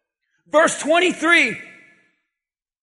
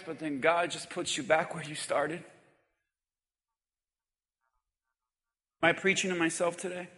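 zachte verteltoon preek; 7 semitones lower, 10 dB quieter than usual. Acted. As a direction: draw them in softly with the story. Quiet retelling of grieving mother.

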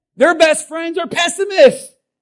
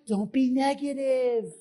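quieter, slow, sanctimonious put-down; a lightly voiced holier-than-thou voice; mock preachy scold; 8 dB quieter than usual. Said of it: "don't you dare tell someone".

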